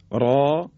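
"را" with a thick R and open alef; heavy R. Shown as a demonstration. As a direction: say "را" full and heavy. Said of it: "رای اول که با الف اومده". («را» درشت و مفتوح)

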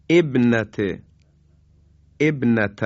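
ابنت started with a kasra as ebnat, loud and clear; emphatic ebnat; Quranic recitation. Shown as a demonstration. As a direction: begin ابنت with an e-sound.

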